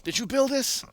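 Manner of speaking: high-pitched question in a mock foreign accent; Farzad's accent. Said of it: "in his accent".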